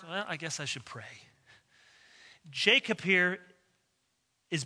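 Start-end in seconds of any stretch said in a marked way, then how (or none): 0.0-1.3 much softer, resigned "Well, I guess I should pray."; resigned mutter. Retold or imitated. Someone giving up at last, softly resigned. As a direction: sigh it out as a reluctant afterthought. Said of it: "and if that doesn't work".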